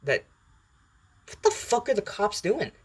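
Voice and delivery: high-pitched startled swearing; real bewilderment. Real, not acted. Speaker interrupted by police sirens outside, high and baffled. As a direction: break off, baffled, voice shooting up.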